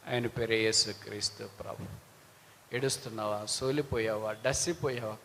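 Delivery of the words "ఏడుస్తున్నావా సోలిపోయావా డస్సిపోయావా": low, soft questions to the weary; tender asking. Acted. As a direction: lower the voice and ask with care. Tenderly addresses the weeping listener.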